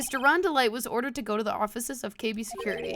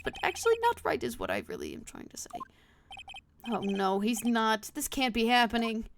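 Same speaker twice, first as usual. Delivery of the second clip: ordinary talk, nothing marked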